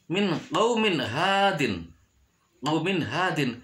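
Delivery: "qaumin hadin" with a short clear n; "qaumin hadin", n cut cleanly before the h; clear idhar reading. Shown as a demonstration. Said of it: "tidak boleh dibaca".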